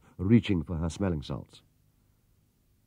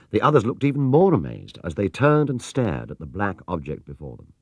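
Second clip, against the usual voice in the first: usual speaking voice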